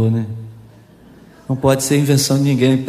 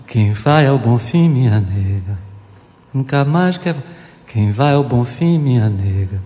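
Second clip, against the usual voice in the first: lyrics just spoken; 5 semitones lower, 5 dB quieter than usual. Shown as a demonstration. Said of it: "parece que tá falando, ó".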